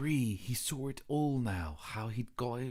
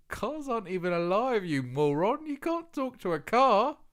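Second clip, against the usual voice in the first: high, loud mocking through giggles; laughing mockery; 12 semitones higher, 10 dB louder than usual. Holly laughing at Varjak for talking to cars, high and loud. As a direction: laugh at him as you shout.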